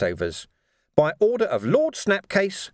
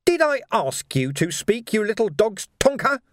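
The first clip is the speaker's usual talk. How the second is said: menacing rebuke; 10 semitones higher than usual. Acted. Gang boss rebukes his cowering underling.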